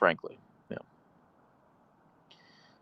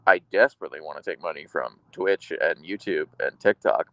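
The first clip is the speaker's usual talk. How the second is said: ordinary talk, nothing marked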